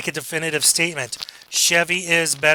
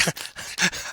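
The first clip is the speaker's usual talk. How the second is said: breathy put-on giggle; mock giggling; 3 semitones higher, 6 dB quieter than usual. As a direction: imitate a friend's uncontrollable giggles. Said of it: "they're laughing and giggling".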